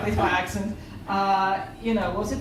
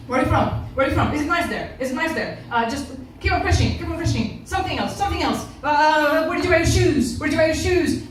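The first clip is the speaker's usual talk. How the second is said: higher-pitched mock chatter, each question repeated; pestering questioner; 6 semitones higher than usual. Man pestering a woman on the tube, insistent higher-pitched questioning. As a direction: imitate someone desperately keeping a conversation going.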